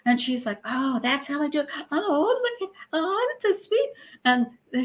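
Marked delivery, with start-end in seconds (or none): 0.7-3.9 put-on very high, gushing baby-talk coo; syrupy fake mother voice, mocked; mock sweet cooing. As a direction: coo over the baby like a fake doting mum. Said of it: "a very sweet image".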